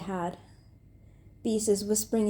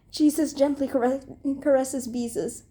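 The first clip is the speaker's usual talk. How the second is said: usual speaking voice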